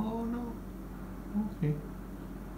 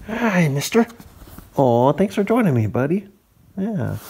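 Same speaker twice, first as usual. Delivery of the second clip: louder, higher delighted hello; excited greeting; 7 semitones higher, 7 dB louder, slower than usual. Real, not acted. Loud excited greeting to cat.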